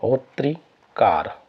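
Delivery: drawn-out होत्रिकार, syllable by syllable; slow dictation. Shown as a demonstration. Teacher dictates होत्रिकार slowly to students. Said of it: "एक शब्द आता है होत्रिकार".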